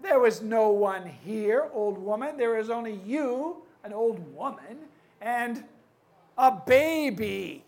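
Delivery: loud, indignant chief's complaint. Acted. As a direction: boom it out at the old woman. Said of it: "his voice was so loud".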